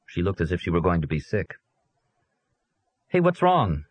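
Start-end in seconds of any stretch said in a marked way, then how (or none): 3.1-3.8 put-on high, eager boy calling out; boy's high, worried question; young boy voice. A narrator's reading. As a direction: voice him as a curious kid, pitched high. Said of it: "he asked her".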